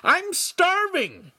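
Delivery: very high, slow scream; screaming back. A narrator's reading. Starving apprentice answers master, screaming back. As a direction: scream it back, stretching each word. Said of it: "Lincoln screamed back".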